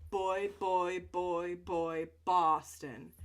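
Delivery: put-on loud, punchy Boy and Boston with popped B's; loud jaw-relaxing chant of Boy and Boston; loud repeated phrase. Shown as a demonstration. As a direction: pop each B hard to loosen the jaw. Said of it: "so we're gonna say".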